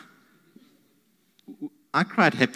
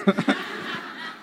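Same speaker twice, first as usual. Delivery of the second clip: breathy laugh. Real, not acted. Laughs, moved, after tears remark.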